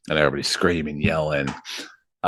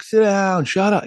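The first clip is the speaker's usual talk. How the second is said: high-pitched mock heckle, only mildly raised; imitated audience yelling; 13 semitones higher than usual. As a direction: imitate a fed-up crowd calling out. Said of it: "They're yelling things out".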